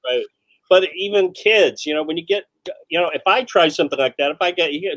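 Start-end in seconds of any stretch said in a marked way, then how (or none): none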